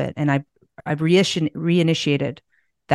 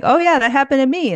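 casual retold reply; 9 semitones higher, 4 dB louder, faster than usual. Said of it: "And he's like".

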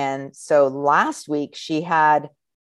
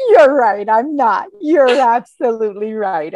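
playful agreement; 6 semitones higher, 5 dB louder than usual. Retold or imitated. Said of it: "And I'm like".

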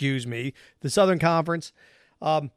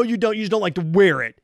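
normal talking voice

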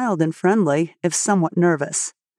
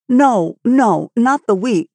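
emphatic denial; 4 semitones higher, 4 dB louder, slower than usual. A narrator's reading. Anne corrects alarmed farmer.

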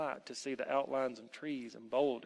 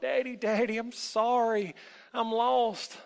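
high, loud, plaintive call; crying out; 9 semitones higher, 5 dB louder than usual. A narrator's reading. Lost boy calls out to his father, tearfully. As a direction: wail for daddy like a lost child. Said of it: "cried. Ever so often, he would call out".

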